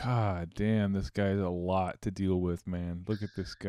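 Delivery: soft and low, under the breath; muttered quietly to himself; muttering. Real, not acted. Muttering at infomercial guy.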